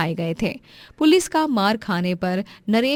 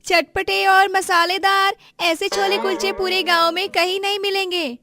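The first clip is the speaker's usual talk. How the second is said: street hawker's cry; 12 semitones higher, 4 dB louder than usual. Acted. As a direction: call out your wares to passers-by. Revati hawks her food. (ठेले वाली की ऊँची, खिंची पुकार)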